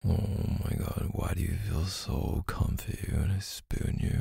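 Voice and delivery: normal talking voice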